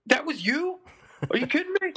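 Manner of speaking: mock astonished squeal of disbelief; shocked disbelief. Brother-in-law learning he's the drummer, in high mock shock. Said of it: "He's like".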